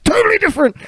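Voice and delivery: loud falsetto protest; mock insistence. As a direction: squeal it in exaggerated denial. Mock insistence in acted exchange.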